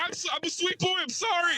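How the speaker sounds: very high, whiny mock apology; mock pleading. Scared driver begging the border cop, high and whiny. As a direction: beg like a scared kid.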